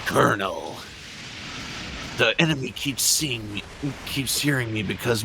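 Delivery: gravel-free but gruff covert soldier; snake voice. Pretend. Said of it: "Say that again, but with snake voice".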